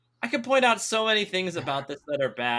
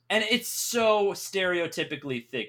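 ordinary talk, nothing marked

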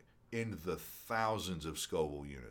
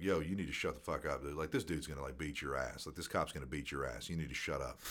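hushed, low-pitched retold warning; low urgent aside; 5 semitones lower, 5 dB quieter than usual. Quietly warning a drunk friend about the cop, low aside. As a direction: keep it down, nearly a whisper. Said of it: "kinda telling him, not whispering".

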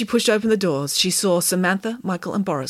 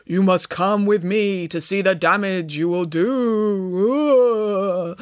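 slow, drawn-out spooky moan; moaning ghost; 3 dB louder, much slower than usual. A narrator's reading. Derek as ghost summoning Nanny, slow ghostly moan. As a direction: moan it like a pretend ghost, trailing into a wail. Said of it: "moaned the ghost of Easter future".

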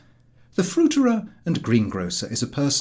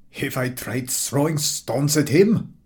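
slow, scornful echo of the question; fed-up man mocking Harris's question; contemptuous; slower than usual. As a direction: throw the question back with contempt. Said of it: "replied the man contemptuously".

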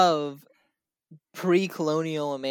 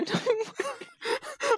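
mock wailing; 5 dB quieter, much slower than usual. Mock wail, repeat of 179.

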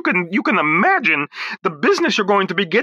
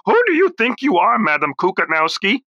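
ordinary talk

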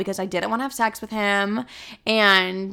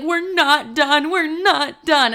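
high, slow, drawn-out mock of the ex; menacing ex; 7 semitones higher, 4 dB louder, much slower than usual. Ex swerving the car, mocked high and drawn out. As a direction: drag each word out high like an unhinged boyfriend.

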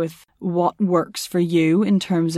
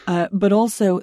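normal talking voice

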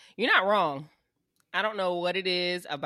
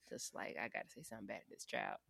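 hushed admission; 8 semitones lower, 18 dB quieter than usual. Retold or imitated. Admitting near-mean reply.

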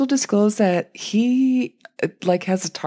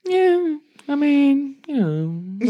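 high-pitched, noncommittal mock shrug; drawn-out, airy "whatever" tone, sent up; imitating lackadaisical Brittany; 9 semitones higher, much slower than usual. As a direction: play it couldn't-care-less, pitched way up. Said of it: "she's just so lackadaisical about it".

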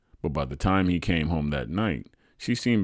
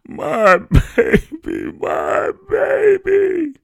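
high, slow, drawn-out keening; crying mother; 11 dB louder, much slower than usual. A narrator's reading. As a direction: cry it out slowly while rocking in grief. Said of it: "still crying and rocking back and forth".

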